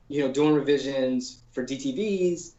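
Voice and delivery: ordinary delivery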